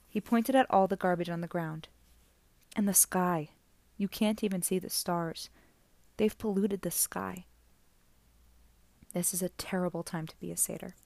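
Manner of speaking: usual speaking voice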